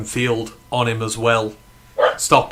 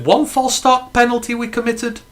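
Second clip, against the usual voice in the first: very high, incredulous question; can hardly believe it, voice way up high; high-pitched incredulity; 11 semitones higher than usual. Real, not acted. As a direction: squeal it in disbelief, much higher. Mock amazement at few penalties.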